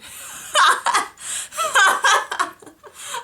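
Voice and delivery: loud, high-pitched cackling burst; real laughing fit. Real, not acted. Cracks up at slang meaning.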